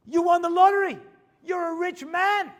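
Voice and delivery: high, loud, breathless announcement; the store owner bursts out with the lottery news, high and loud; excited news. Retold or imitated. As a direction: blurt out the big win.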